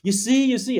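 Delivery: high, eager mock chatter; excited audience chatter. Audience members discussing among themselves, excitedly. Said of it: "And they".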